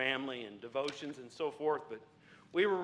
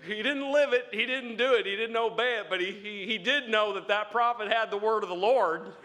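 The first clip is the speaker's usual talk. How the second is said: fiery preaching; 4 semitones higher, 4 dB louder than usual. Real, not acted. Preaching at full force.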